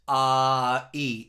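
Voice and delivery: ordinary talk, nothing marked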